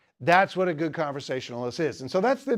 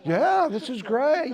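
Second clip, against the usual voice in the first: mock enthusiasm; 9 semitones higher, slower than usual. Mock enthusiasm over nachos.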